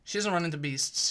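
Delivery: normal talking voice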